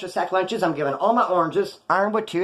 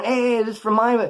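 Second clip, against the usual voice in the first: higher, mildly raised calling voice; retold holler; 5 semitones higher, 3 dB louder than usual. Annoyed man hollers down that it's his, retold holler. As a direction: imitate someone hollering down the line. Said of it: "he had to holler down".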